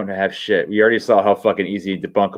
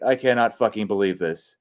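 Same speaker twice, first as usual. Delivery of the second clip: ordinary delivery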